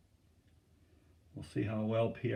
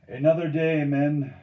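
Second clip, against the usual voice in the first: loud, raised call to the crew; 4 semitones higher, 10 dB louder than usual. A narrator's reading. Steersman gives up the race, calling to his men. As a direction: project it over the paddling.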